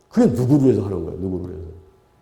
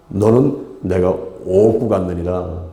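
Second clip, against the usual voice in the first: slow, low, solemn divine reply; the Lord answering; 5 semitones lower, much slower than usual. Acted. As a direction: drop low and draw the words out. Acted Lord answers the believer. (낮고 느리게, 엄숙한 주님의 대답)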